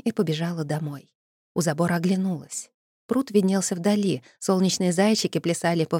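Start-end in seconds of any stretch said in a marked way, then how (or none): none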